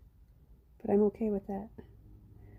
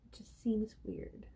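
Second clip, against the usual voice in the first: slow whispered aside; 10 dB quieter than usual. Real, not acted. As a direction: breathe it out, slowly. Quiet aside about humming.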